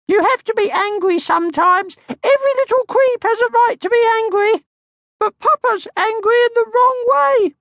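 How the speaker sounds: tiny cheeky creature voice; little Mai. Acted. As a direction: squeak it like a small bossy girl. Little Mai's voice.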